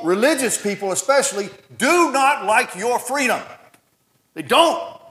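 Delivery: high and loud, shouting the joy out; preacher rousing the congregation at full force; fiery preaching. Acted. Pastor telling church about freedom, fiery shouted preaching.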